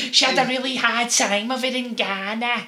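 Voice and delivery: high, very loud hammed-up Liverpool accent; mock Scouse medium. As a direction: boom it out like a Scouse psychic. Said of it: "he has this strong Liverpudlian accent".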